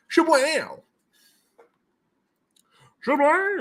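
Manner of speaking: very high-pitched playful cry; silly exclamation. Pretend. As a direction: squeal it as a cartoonish flourish. Silly exclamation on sending prizes.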